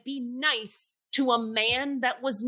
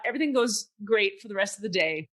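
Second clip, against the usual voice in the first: usual speaking voice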